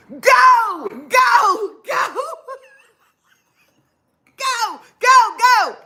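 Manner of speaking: high, loud urgent yells of a man fleeing; screaming getaway. Retold or imitated. Friend running out of the house to the car, screaming. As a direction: yell it as if running for your life.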